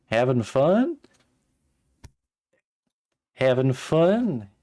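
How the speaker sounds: drawn-out Southern Having fun?. Shown as a demonstration. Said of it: "Should I give him, like, a hick accent?".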